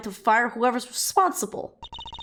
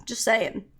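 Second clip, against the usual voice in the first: normal talking voice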